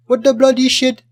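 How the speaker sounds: high, loud mock Indian accent. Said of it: "with the, the Indian accent".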